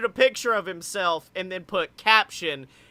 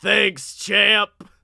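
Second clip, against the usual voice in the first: usual speaking voice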